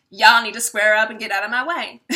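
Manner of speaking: loud, brash mock demand; hammed-up loud command; mock loud bluster. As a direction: play the pushy king shouting folks aside. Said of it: "which is the King of Wands".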